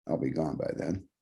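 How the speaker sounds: ordinary delivery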